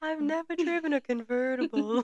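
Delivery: high-pitched sappy suitor imitation; hammed-up sweet-talker voice; Gary impression. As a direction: put on an earnest, wonderstruck confession. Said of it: "how Gary talks".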